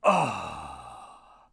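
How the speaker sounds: long, low sigh of relief; sighing after a welcome drink; satisfied sigh. A narrator's reading. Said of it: "swallowed it and sighed".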